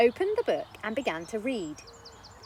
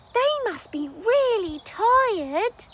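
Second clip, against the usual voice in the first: put-on high, slow little girl's voice; girl noticing tired parents; thoughtful child; 12 semitones higher, 6 dB louder, slower than usual. Child concluding.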